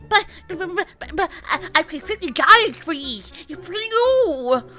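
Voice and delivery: flustered, scrambling to argue; panicky stammer. Acted. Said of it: "starts to panic".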